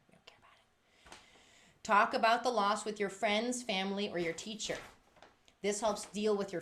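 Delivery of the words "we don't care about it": breathy joking whisper; conspiratorial whisper to Elaine. Real, not acted. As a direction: whisper it as a final aside. Ends in whisper.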